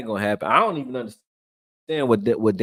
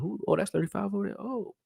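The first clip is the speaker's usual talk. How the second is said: high, startled "who? oh" with rising surprise; surprised double take; 11 semitones higher, 7 dB quieter than usual. Retold or imitated. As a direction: react like you just spotted a player out of nowhere. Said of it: "made me look at him like".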